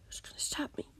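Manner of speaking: menacing whisper. A narrator's reading. Villain menacing quietly.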